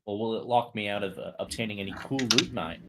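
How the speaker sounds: ordinary talk, nothing marked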